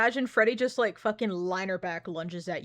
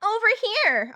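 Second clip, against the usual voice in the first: higher and louder copy of the call; mocking high call; 11 semitones higher, 4 dB louder than usual. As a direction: echo the lure call up high. Echoing the lure.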